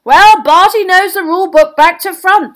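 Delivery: high, loud, cheerful; hearty beaming announcement; jovial Bagman figure. A narrator's reading. Beaming judge backing Crouch's ruling, jovially. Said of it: "beaming and turning back".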